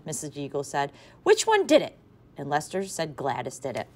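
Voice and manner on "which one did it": exasperated. A narrator's reading. Mother pressing for answer.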